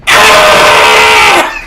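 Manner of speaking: loud shrieking scream; loud mock shriek; screech. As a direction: let out a wild screech.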